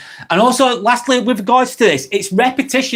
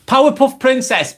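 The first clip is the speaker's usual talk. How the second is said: calling out; 8 semitones higher than usual. Real, not acted. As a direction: hail the chatter to catch their attention. Calling out a chatter's name.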